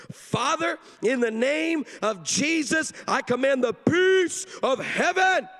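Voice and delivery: high, loud, slow mock preaching; hammed-up booming prayer with stretched words; commanding prayer. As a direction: imitate a child praying at full force. Daughter's full-force prayer, imitated.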